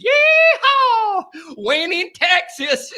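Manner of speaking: loud, high cowboy holler in a Texas twang; mock Texas accent. Host mimicking coach showing off cowboy boots, mock Texas accent. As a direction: whoop it up with a hammed-up Texas accent. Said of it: "The latest accent".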